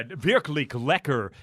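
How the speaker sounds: put-on slow German with a heavy American accent; mock the Ben and Jerry's ad's American German. Mocking the ad's American accent in German, slow. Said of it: "where they do the American accent".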